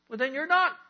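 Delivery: sharp high loud comeback; emphatic retort. Retold or imitated. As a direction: slam the answer home. Pastor's punchline to the couple.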